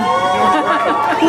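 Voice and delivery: short nervous laugh. Real, not acted. Laughs after admitting discomfort.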